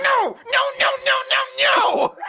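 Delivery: very high hammed-up shouting; mock tantrum; infuriated. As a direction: shriek a string of refusals at a clerk. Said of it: "are infuriated to a certain extent".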